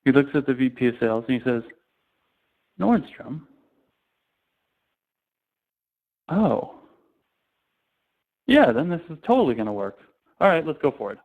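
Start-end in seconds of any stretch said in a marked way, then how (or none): 2.8-11.2 higher, a surprised turn to delight; mock CEO getting it all at once; sudden realization. CEO hearing Nordstrom, surprised and suddenly won over.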